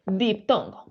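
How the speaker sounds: usual speaking voice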